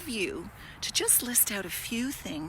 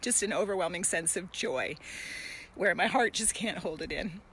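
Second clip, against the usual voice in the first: choked up with joy; getting emotional. Real, not acted. Host overcome with joy while talking to viewers, choked up. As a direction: voice catching, moved to tears. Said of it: "why am I getting emotional".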